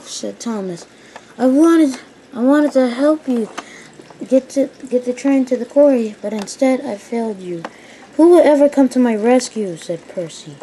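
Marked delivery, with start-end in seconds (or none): 1.4-9.8 high, loud woeful plea; mildly hammed-up distress; acted lament. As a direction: lament failing a friend. Crashed engine laments his failure.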